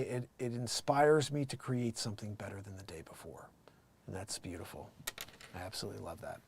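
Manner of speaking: very soft, low, near-whisper; low murmur of appreciation; hushed reflection. Real, not acted. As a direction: let it sink almost to nothing, sincerely.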